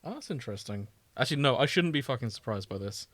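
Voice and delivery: usual speaking voice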